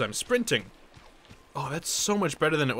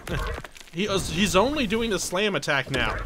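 normal talking voice

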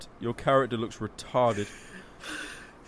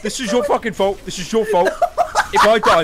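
heated blaming; 7 semitones higher, 7 dB louder than usual. Real, not acted. Blaming friend while in danger.

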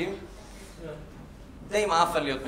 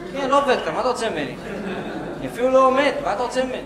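higher-pitched, put-upon objection; exasperated protest; 7 semitones higher, 3 dB louder, faster than usual. Acted. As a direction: protest at being mistaken for staff. Mistaken for staff, protests.

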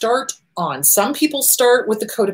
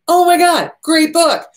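high, louder burst of enthusiasm; excited gushing; 8 semitones higher, 6 dB louder, slower than usual. Real, not acted. Therapist raving to listeners about a favorite book, excited gushing. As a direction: rave about it, pitched right up.

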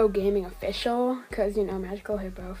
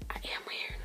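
whispering; 14 dB quieter than usual. Real, not acted. Whispered self-remark.

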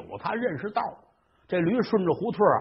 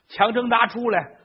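usual speaking voice

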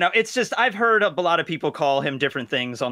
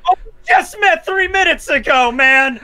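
incredulous protest; 11 semitones higher, 6 dB louder than usual. Real, not acted. Player protests in-character betrayal.

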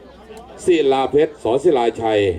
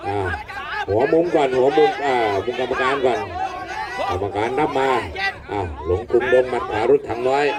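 live fight play-by-play; excited boxing commentary. Real, not acted. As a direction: call the punches as they land.

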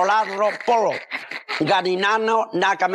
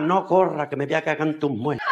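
lower-pitched acted husband; marido rezagado; 6 semitones lower than usual. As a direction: grumble it low after her. Husband trailing behind runaway bride. (voz grave de marido rezagado)

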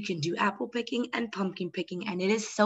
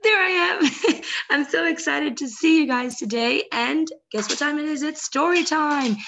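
put-on high, bright-eyed kids' host welcome, a bit louder; excited greeting; 6 semitones higher, 4 dB louder than usual. Host welcoming children to story time, excited and high. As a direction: greet the little ones with big excitement, pitched up.

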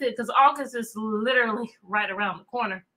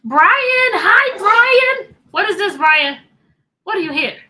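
much higher, much louder happy calling of "Brian!"; excited greeting; 8 semitones higher, 11 dB louder, slower than usual. Real, not acted. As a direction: shout a joyful hello across the room.

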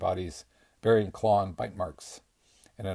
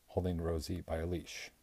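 usual speaking voice

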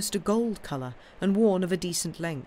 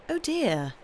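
high-pitched little exclamation; mild dismay; 10 semitones higher than usual. Acted. Aunt hearing her nephew's plan, mild dismay. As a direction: react with concern.

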